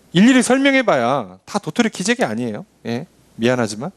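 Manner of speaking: ordinary talk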